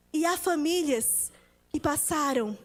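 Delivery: ordinary talk, nothing marked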